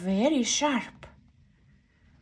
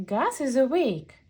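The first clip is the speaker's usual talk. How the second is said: ordinary delivery, nothing marked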